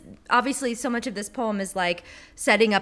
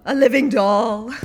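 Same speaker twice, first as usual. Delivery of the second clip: slow, louder English-Boston poetry reading; Sylvia Plath accent; 8 dB louder, slower than usual. Retold or imitated. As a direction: recite like Plath in her old recording. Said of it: "her strange English Boston accent".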